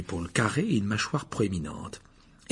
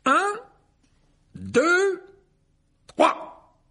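high, loud, drawn-out count; pompous countdown; 5 dB louder, much slower than usual. A narrator's reading. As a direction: count off the duel theatrically. Countdown to the duel.

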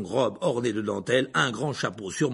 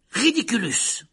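high, raised spell cry; shouted spell; 7 semitones higher, 4 dB louder, slower than usual. A narrator's reading. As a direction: cry out the incantation. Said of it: "cria-t-il". (incantation criée)

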